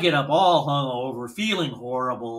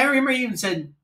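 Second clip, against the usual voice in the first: usual speaking voice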